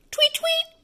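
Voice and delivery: high chirpy birdcall tweets; bird tweet. Retold or imitated. Showing a toddler how birds sound, high chirping tweets. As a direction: chirp it like a little songbird. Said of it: "That's how the birds go".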